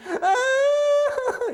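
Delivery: high, loud mock wail; imitated screaming cry. Girl screaming once the block drops, mock wail. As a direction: burst into a child's shrill wail. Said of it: "she started screaming again".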